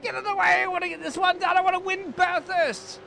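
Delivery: high, loud frantic yell; excited yell. Real, not acted. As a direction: scream at the car ahead to move. Urging car past traffic.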